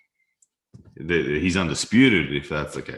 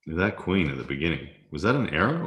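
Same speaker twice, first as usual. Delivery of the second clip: normal talking voice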